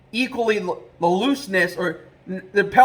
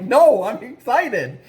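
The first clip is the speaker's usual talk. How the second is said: slow, higher, excited protest; retold excitement; 4 semitones higher, much slower than usual. Trapper setting his worried dad straight, acted-out excitement. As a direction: stretch "no" into an excited insistence. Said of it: "I was just super excited".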